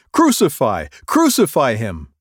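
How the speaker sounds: loud mob cry; crowd shouting. Acted. Crowd crying out.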